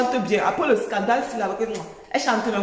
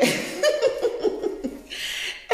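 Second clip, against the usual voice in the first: airy laugh up high; high breathy laugh; 12 semitones higher than usual. Real, not acted. Laughing at mocking comment.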